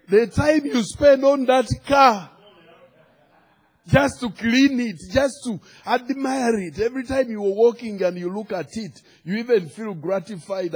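high, insistent pulpit voice; fervent preaching. Real, not acted. Preacher chiding congregation over love of a car, fervent preaching. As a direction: press the point on the congregation.

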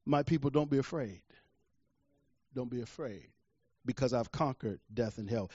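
low, soft, calming voice; tender reassurance. Retold or imitated. Jesus reassures his people after conquering death, soft and gentle. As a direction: soothe them quietly, letting the pitch drop.

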